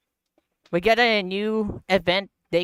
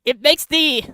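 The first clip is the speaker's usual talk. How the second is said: loud, high excited gasps with laughter; can't believe how strong it is; amazed; 4 semitones higher, 6 dB louder than usual. Real, not acted. Gamer marvelling at the explosion, loud giddy excitement.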